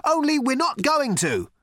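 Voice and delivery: high, projected shout. A narrator's reading. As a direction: finish the shouted apology. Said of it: "shouted Ford".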